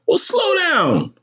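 very high, drawn-out maternal snap; snapping. A narrator's reading. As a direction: snap at the child, stretching the words. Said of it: "my mother snapped".

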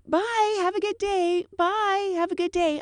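high singsong attendant farewell; chirpy goodbye. Retold or imitated. Attendant chirps goodbyes to deplaning passengers, high singsong. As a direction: sing the goodbyes on autopilot.

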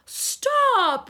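high, loud yelp of stop; mock outrage. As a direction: squeal it in fake shock.

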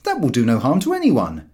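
a jolly beer-lover lays down his rule; George's voice. A narrator's reading. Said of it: "said George".

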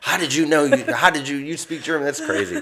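high, astonished questions; amazed tourists. Retold or imitated. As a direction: mimic tourists stunned he speaks German. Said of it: "they're like".

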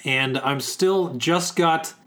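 ordinary talk

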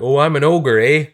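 lightly hammed-up Canadian accent with a tag eh; Canadian accent Shrek. Comedian's rejected idea, Shrek in a mild mock Canadian accent. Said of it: "Shrek with a Canadian accent".

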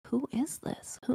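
breathy whisper to yourself. Retold or imitated. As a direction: whisper it, puzzled, under your breath. Inner thought, unvoiced.

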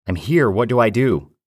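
loud, high, urgent calling out; shouting for help over the chaos; desperate call. Acted. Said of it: "I called out".

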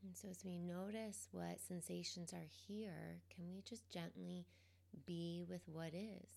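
calm, quiet guidance, leaving space between phrases; guided meditation. Real, not acted. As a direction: gently guide them into the present moment, unhurried.

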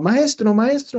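imitating children hailing their teacher; kids calling out. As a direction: call out like excited little kids.

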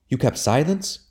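high, shocked question; indignant cry. A narrator's reading. Marjorie to Robin on his silence, shocked cry. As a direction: cry out in disbelief. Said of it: "she cried".

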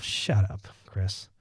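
usual speaking voice

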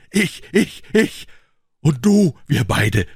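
the scream he swallowed; held-back outcry. Retold or imitated. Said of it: "ihm entgegenzuschreien". (unterdrückter Aufschrei, viel höher und lauter)

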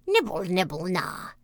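lightly voiced witch calling from indoors; soft witch voice. A narrator's reading. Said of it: "a soft voice cried from the room".

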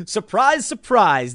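mock amazement, rising high then dropping; a sing-song, sarcastic "Surprise, surprise" that starts high and falls; fake-surprised "Surprise, surprise" with a rise then a fall. Real, not acted. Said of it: "sarcastic remark on predictable news".

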